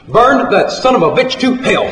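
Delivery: high, forceful call to the kitchen; hollered punchline. A narrator's reading. Cafe owner tells the cook to burn the preacher's steak, hollering. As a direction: holler the order high with relish.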